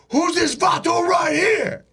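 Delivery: high, drawn-out holler; menacing shout. Retold or imitated. Face-tattooed dude confronts the man he yanked up, menacing shout. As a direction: bellow it like a challenge, stretching the words. Gangster grabs and confronts him.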